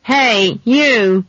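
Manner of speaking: loud, high call to stop someone. A narrator's reading. Man shouting after the departing girl, calling out. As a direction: raise pitch and volume to hail them.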